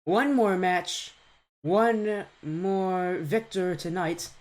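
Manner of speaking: slow, drawn-out announcer build-up; hype caster opener. Acted. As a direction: announce it like a main event.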